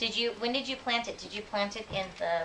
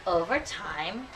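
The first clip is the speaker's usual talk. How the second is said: drawn-out storytelling; much slower than usual. Acted. Teacher reading, drawing out tiny pumpkin.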